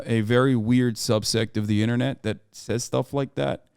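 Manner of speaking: ordinary talk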